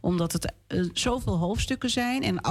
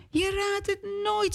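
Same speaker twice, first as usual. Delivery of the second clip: high, beaming announcement; bursting with good news; happy; 13 semitones higher than usual. Acted. Papa tells Hanna the news, high and happy. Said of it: "zegt papa blij".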